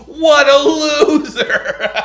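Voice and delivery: high, loud, drawn-out mockery breaking into laughter; gleeful, stretched-out ridicule; jeering shout. Real, not acted. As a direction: jeer it slowly through a laugh. Jeering at Gino after breakup clip.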